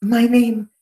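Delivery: high, formal aristocrat; grand noble. Acted. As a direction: announce yourself with pomp. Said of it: "He will say".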